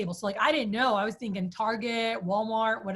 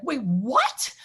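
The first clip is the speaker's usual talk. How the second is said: louder "what", shooting upward; shocked disbelief; 2 semitones higher, 5 dB louder than usual. Retold or imitated. Daughter hearing dad's mattress price, shocked. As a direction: act out being floored by the answer.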